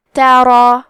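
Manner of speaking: ترا with a thick, full ra; heavy ra. Shown as a demonstration. Teacher demonstrates the heavy ra, thick full ترا. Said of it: "حرف را کو موٹی آواز میں پڑھیں گے".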